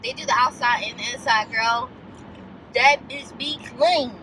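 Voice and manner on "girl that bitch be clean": ordinary talk, nothing marked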